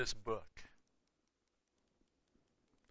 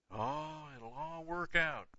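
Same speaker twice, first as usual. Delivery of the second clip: mocking naive reassurance; 5 semitones higher, 5 dB quieter, slower than usual. Mocking believers' vague reassurance.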